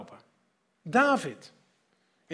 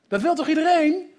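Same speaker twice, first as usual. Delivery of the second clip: excited rhetorical question; 8 semitones higher, 8 dB louder than usual. Real, not acted.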